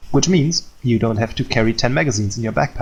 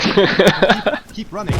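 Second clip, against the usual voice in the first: high, fast mock panic yells; hammed-up alarm under fire; panicked shouting; 9 semitones higher, 4 dB louder, much slower than usual. Instructor fakes a mortar attack.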